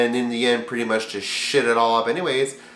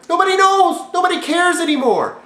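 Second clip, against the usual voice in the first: exclaiming; 5 dB louder than usual. Acted. Ranting punchline.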